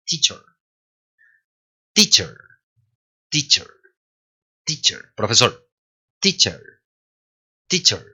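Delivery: "teacher" with a weak, nearly silent E at the end; correct English pronunciation. Shown as a demonstration. Teacher modelling the word for students, correct weak ending. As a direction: say "teacher" lightly, ending in a faint schwa. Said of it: "La pronunciación correcta de teacher es teacher".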